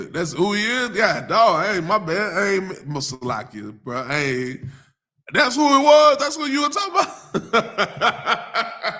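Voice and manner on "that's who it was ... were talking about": high, loud, laughing call-out; excited shout. Real, not acted. Pastor calling out to a viewer in chat, excited and laughing. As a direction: shout it as the joke lands.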